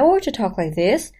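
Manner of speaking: put-on naive voice with swooping pitch; dumb, innocent voice. Shown as a demonstration. Teacher showing how voice shapes perception, naively innocent. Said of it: "you think I'm dumb and innocent".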